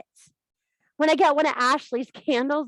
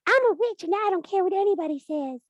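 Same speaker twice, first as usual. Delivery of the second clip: mock girly declaration; 9 semitones higher than usual. Mocking young girls' declaration.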